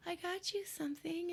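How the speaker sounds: put-on high, soft, sugary girlfriend; a girlfriend cooing a gift at dinner; sweet girlfriend voice.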